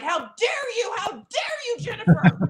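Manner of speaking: hammed-up offended accuser shouting; mock outrage. Pope defenders attacking Jennifer, mock indignant shout. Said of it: "And they were like".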